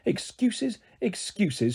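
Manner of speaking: understated scornful dismissal; contemptuous. A narrator's reading. Small fairy doubting the girl can fly, contemptuous. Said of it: "looked at me with contempt".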